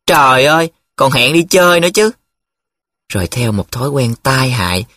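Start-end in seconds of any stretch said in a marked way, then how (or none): 0.1-2.1 higher, louder excited cry; high loud teasing exclamation; exclaiming loudly. A narrator's reading. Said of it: "Nhiệm lại la lên".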